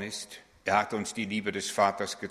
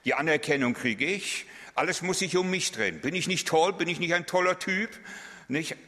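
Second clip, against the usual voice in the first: much higher, preening boast; vain show-off; 8 semitones higher than usual. Pretend. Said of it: "Ich, ich am ichsten". (hoch, eitel prahlend)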